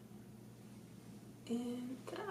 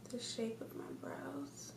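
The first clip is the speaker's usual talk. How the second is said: whispering; 7 dB quieter than usual. Real, not acted. Whispered while concentrating on brows.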